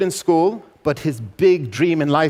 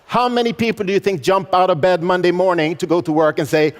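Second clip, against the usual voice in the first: usual speaking voice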